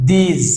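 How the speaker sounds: "দিস" ending in a buzzing z; voiced final S. Shown as a demonstration. Teacher showing how these ends, voiced final S. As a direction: say "দিস" as deez. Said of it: "বর্গীয় জ উচ্চারণ হবে".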